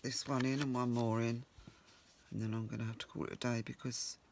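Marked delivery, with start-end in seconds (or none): none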